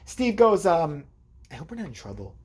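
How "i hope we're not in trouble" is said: low, hushed worry; quiet mock-sheepish murmur; imitated nervous friend. Imitates friend's worried confession.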